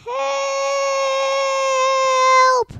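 long, high, loud cry for help; drawn-out, desperate high yell; call for help. A narrator's reading. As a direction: scream it out and hold the word. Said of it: "called Toby".

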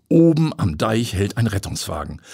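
usual speaking voice

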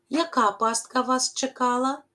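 ordinary talk

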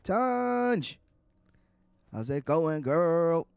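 high, loud call of a name; excited greeting. Real, not acted. Player greeting the friendly NPC with a loud, high call. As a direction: call out to her, delighted. Greeting a game character loudly.

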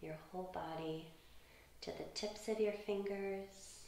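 soft, unhurried meditation voice; gentle and hushed, lingering on phrases; guided relaxation. Real, not acted. Leads children's relaxation.

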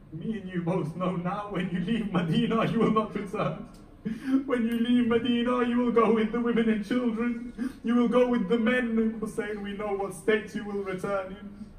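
loud, high, mournful declaration; grieving proclamation. Acted. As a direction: cry out the fate with rising grief. Prophet foretells Hussein's fate.

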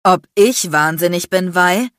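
loud, outraged retort; agitated roar. A narrator's reading. Enraged man answers the woman's accusation, agitated roar. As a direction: throw the question back furiously. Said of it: "brüllte er mich aufgebracht an".

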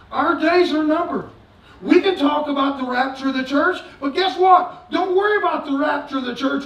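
shouting sermon, high and loud; fiery preaching. Acted. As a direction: preach it at full force to the congregation. Preaching at full force.

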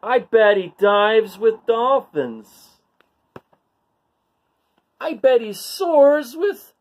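imagine the fun you're missing; envious barnacle. Acted. Bored barnacle envies the fish, high and wistful.

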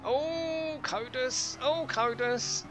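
high, drawn-out anguished wail; anguished cry. Real, not acted. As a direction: cry out in dismay as he crashes. Crash, crying out to teammate.

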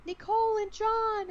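high, loud tearful cry of recognition; crying out names. Retold or imitated. Under hypnosis, calling to her friends, crying out. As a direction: call the names through tears. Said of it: "I was crying and I was like".

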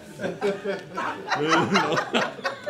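a real laugh at his own joke. Real, not acted. Preacher to the congregation, a real laugh. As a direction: laugh along with the room.

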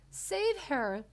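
high, loud plea; cry out. A narrator's reading. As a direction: cry out as a desperate mother. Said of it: "cried Missus Beauregard".

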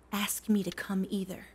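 breathless whisper of farewell. Acted. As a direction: let it fall to a whisper. Wife's tearful parting words.

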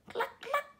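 very high clucking; chicken clucking. Acted. Chicken answering Duck with high clucks. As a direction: cluck like a hen. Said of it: "said Chicken".

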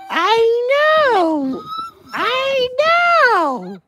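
very high, long squeak on know; squeaky pet voice. Pretend. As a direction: squeal it like a baby animal.

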